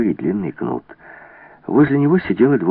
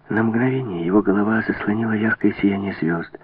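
plain reading voice

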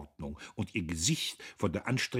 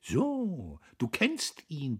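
high, mocking, drawn-out scorn; sneering; 9 semitones higher, 4 dB louder, slower than usual. A narrator's reading. Käthchen picks up on her rival's slip, sneering. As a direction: jeer at her with raised pitch. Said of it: "bemerkte Käthchen höhnisch".

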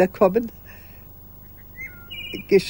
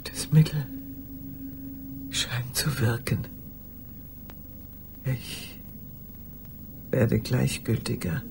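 whispered inner thought; 6 semitones lower, 11 dB quieter than usual. Acted.

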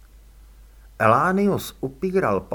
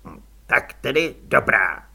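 stiffly; 4 dB louder than usual. A narrator's reading. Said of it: "prohlásil škrobeně".

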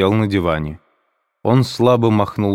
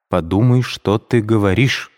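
ordinary delivery

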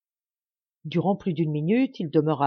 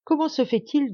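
raised-pitch, accusing demand; menacing question; 8 semitones higher than usual. A narrator's reading. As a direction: challenge a suspect with a touch of threat. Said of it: "demanda l'un d'eux d'un ton de menace".

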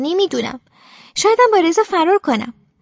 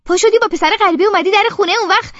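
higher, tense angry accusation; through gritted teeth; 5 semitones higher, 4 dB louder than usual. A narrator's reading. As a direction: spit it out through clenched teeth.

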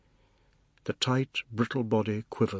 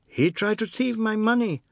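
put-on high, louder child's voice; accusing little boy; 11 semitones higher, 5 dB louder than usual. Anand accuses the man before father, high-pitched accusation.

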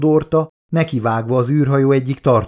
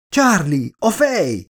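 urgent cry; 7 semitones higher, slower than usual. Acted. Elizabeth jumps off to save head.

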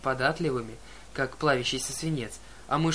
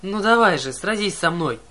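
loud, defiant shout; shouting challenge; 3 semitones higher, 7 dB louder than usual. Acted. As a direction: yell the dare at the monster. Clarisse challenging the monster.

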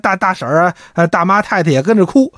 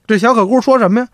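plain reading voice

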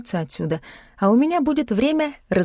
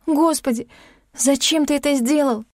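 high-pitched, distraught plea; anguished; 6 semitones higher than usual. A narrator's reading. Heroine breaks away from Klim's embrace, anguished. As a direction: demand a reason, near tears. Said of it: "зарыдала я".

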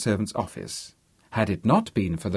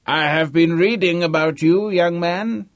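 put-on high, loud old man's voice; crotchety old man; 8 semitones higher, 6 dB louder than usual. A narrator's reading. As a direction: play a cantankerous ninety-year-old. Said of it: "old, and very convincing".

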